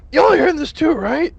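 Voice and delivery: very high, loud jittery guard; spooked guard. Acted. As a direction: squeak it high and scared.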